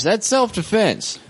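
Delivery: mock incredulous. Real, not acted. Incredulous conclusion.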